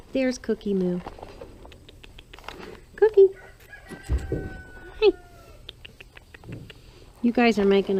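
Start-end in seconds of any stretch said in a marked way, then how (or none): 3.0-5.1 high call to the cow. Real, not acted. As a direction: call her name up high. Calling the cow by name.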